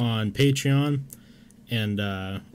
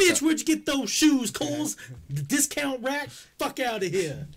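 much higher sneering roast voice; high mocking insult voice; 14 semitones higher than usual. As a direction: hurl the insult in a high put-on voice.

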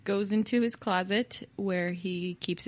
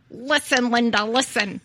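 mock insistence; 2 semitones higher, 5 dB louder than usual. Teller riffs on co-host's Linda joke, loud mock insistence. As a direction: insist playfully, punching each word. Quoting a pleading catchphrase.

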